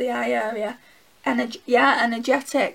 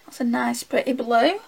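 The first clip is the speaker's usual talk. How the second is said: ordinary talk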